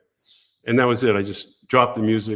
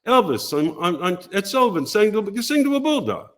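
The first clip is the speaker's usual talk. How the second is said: much higher mock Gary voice; imitating Gary; 8 semitones higher than usual. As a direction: explain it like a hurried producer, up high. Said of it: "He goes".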